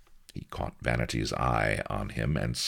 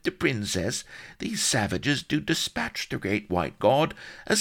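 piteous whimper; 14 semitones higher, 3 dB louder than usual. A narrator's reading. Solomon confesses to Blood in fear, piteous high whimper. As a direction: whimper and beg for mercy. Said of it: "whimpered piteously".

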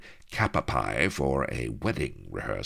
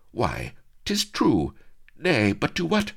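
marvel, then ask; lightly voiced astonished girl; wondering maiden; 10 semitones higher than usual. Vanity wondering.

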